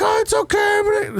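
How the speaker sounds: very high, slow mock reassurance; high-pitched parody of the lady; imitating placating lady. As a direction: coo it way up high, drawn out. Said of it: "she was like".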